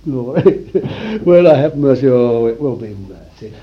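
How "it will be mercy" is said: usual speaking voice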